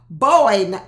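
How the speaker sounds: loud, punched call-out; loud scolding exclamation. Real, not acted. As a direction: call him out loudly on his denial. Calling out Sean's denial.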